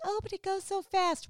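high, lilting mock old lady; sweet old lady. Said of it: "They're like".

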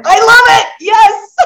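high, loud happy cry; high and loud, laughing "yes"; delighted. Real, not acted. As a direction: exclaim with real glee. Host delighted by a no vote.

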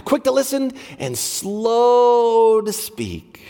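"slow to speak" stretched out slowly; slowed demo phrase. Shown as a demonstration. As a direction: lead the congregation slowly through each word. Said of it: "So here's our action".

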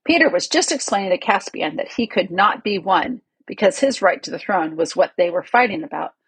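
plain reading voice

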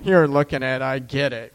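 ordinary talk, nothing marked

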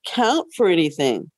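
ordinary talk, nothing marked

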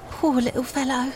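softly voiced Cockney young woman; anxious poor mother. A narrator's reading. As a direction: speak softly, worried for your sick child. Poor mother with sick child.

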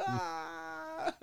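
very high, loud fan squeal; reenacted scream of joy; starstruck squeal. Acts out his starstruck reaction.

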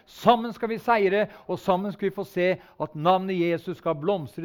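loud, rising preacher's call to the congregation; rally the church with full force, slowing on key words; fervent preaching. Acted. Preacher urging his congregation to move the town, fervent preaching.

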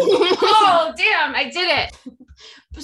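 laughing real, slow on the words. Real, not acted. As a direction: laugh through the admission. Caught in the slip, laughing.

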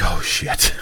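hushed, low uh-oh; retold dread. Reacting to being recognised, hushed dread. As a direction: say it under your breath.

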